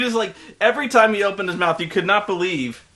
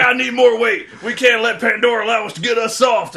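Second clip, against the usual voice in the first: louder, higher macho colonel voice; hammed-up Marine colonel; 5 semitones higher, 4 dB louder than usual. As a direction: puff up like a meathead officer. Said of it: "He's like".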